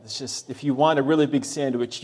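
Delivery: usual speaking voice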